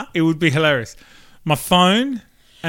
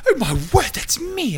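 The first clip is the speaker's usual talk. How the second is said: mock amazement; 12 semitones higher than usual. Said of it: "they'd be like".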